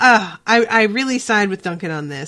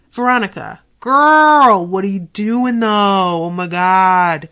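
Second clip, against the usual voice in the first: exasperated scolding; 6 dB louder, slower than usual. Real, not acted. To the on-screen Veronica, louder exasperated disbelief. Scolding a show character aloud.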